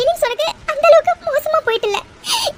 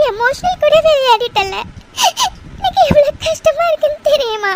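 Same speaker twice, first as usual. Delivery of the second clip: crying; 2 semitones higher than usual. Acted. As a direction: say it through a sob. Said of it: "அழாதடி".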